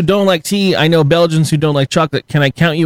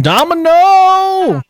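long, stretched high announcer call; excited announcement; 13 semitones higher, much slower than usual. Acted. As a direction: stretch it out like a game show host. Announcing the answer drawn out.

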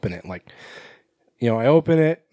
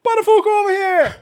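excited shout; 5 dB louder than usual. Acted. Joking name call-out.

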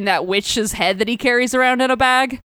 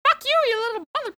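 much higher, fast cartoon witch voice; snarky witch head; 13 semitones higher, 4 dB quieter, faster than usual. Witch's head curses the barbarian, high and fast. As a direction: spit it out like a nasty little hag. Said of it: "and she's just like".

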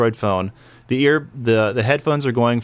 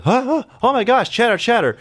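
much higher shocked crowd gasps; mock shocked chatter, pitched up; 10 semitones higher than usual. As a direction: gasp like an appalled crowd. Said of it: "shocked and appalled".

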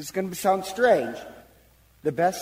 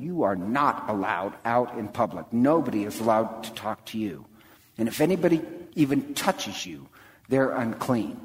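a hint of a stern official, lower; stern enforcer; 6 semitones lower than usual. Jairus the enforcer scolding the woman, lightly voiced stern. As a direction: lay down the law to the outcast.

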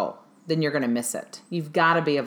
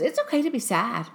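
higher-pitched imitation of the daughter; quoted daughter; 6 semitones higher than usual. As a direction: echo a daughter's words in a higher voice. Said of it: "My daughter, though, she goes".

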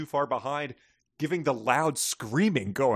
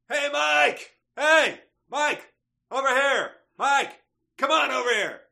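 much higher and louder, calling out to a friend across a crowd; yelling; 4 dB louder than usual. Shown as a demonstration. Demonstrating a yell, calling Mike over to them, much higher and louder. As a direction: shout to get Mike's attention from far away.

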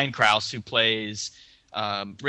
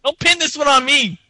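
gangster voice; 8 dB louder than usual. Acted. Joking denial in put-on voice.

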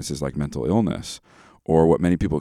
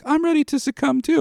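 put-on much higher, little-kid voice; high childlike mimic of a toddler; imitating toddler daughter. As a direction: play the chirpy two-year-old echoing the song. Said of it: "she'll be like".

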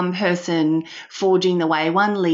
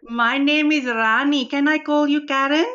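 higher-pitched put-on Indian accent, gushing and familiar; a hammed-up Indian auntie accent, full of darlings; Rani's Indian accent; 4 semitones higher than usual. Host plays Rani greeting Karen, in a playful put-on Indian accent. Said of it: "I've got Rani sitting next to me".

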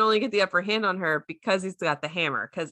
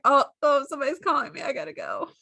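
higher, hurried excuse of a fire giant caught flirting; lightly hammed-up giant; flustered Surtur; 7 semitones higher, faster than usual. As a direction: bail out of a date with a fake call. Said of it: "he's like".

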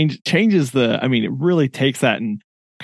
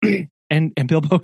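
ordinary talk

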